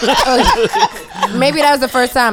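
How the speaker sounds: laughing, voice up high; high, laughing disbelief. Real, not acted. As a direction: tease him while cracking up.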